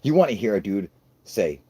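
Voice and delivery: ordinary talk, nothing marked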